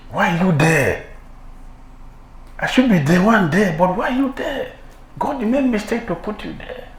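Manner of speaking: indignant jealousy rising in pitch; jealous rival. Acted. Envious rival resenting position.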